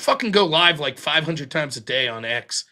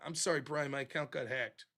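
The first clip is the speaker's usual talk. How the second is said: sheepish mumble; 4 semitones lower, 17 dB quieter than usual. Retold or imitated. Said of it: "I'll be like".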